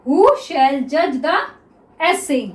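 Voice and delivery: very slow reading; slow dictation. Shown as a demonstration. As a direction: read back slowly for checking. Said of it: "तो ये क्या हो गया students".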